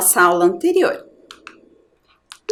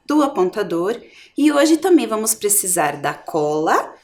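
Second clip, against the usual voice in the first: ordinary talk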